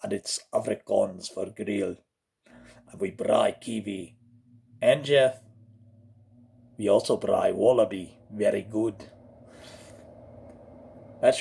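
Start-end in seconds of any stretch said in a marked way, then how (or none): 0.0-9.1 low Afrikaans-accented English; deep, deliberate South African voice. Shown as a demonstration. Explaining braai, low South African accent.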